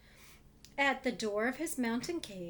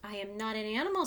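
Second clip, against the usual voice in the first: quick funny thing; much faster than usual. Acted. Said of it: "said the funny thing".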